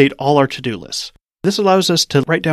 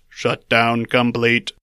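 slow, lower, metallic robot voice; 4 semitones lower, much slower than usual. Shown as a demonstration. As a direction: drag out each syllable like a machine. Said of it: "when the robot voice".

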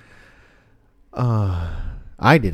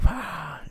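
high-pitched scream held back in volume; mock scream; 13 semitones higher than usual. Retelling the niece's scream in the dark hallway, mock scream. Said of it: "pulling out her fingernails with pliers".

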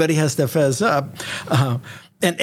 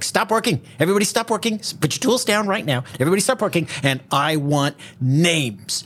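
higher, barking orders at the workers; bossy official; 4 semitones higher than usual. Retold or imitated. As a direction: play a pushy New York bureaucrat halting the work. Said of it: "this whole government attitude about him".

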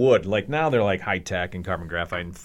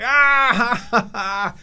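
high, loud mock laugh; imitated burst of laughter; 14 semitones higher, 6 dB louder, much slower than usual. Rival learning about the wooden leg, loud imitated laughing fit. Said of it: "bursts out fucking laughing".

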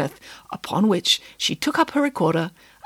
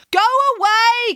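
high, loud shout down from the wall; a frantic mayor yelling at the pig; crying out; 5 dB louder than usual. A narrator's reading. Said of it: "cried the mayor".

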